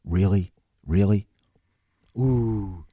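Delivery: low-pitched, unimpressed "really"; mock disapproval. Principal to a cursing student, low imitated disapproval. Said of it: "You just get a look".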